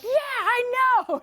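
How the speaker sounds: high, slow, drawn-out angry yell; out-rage the raging guy; stretched, high-pitched mock rage; imitated furious yell. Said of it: "he starts yelling and shouting".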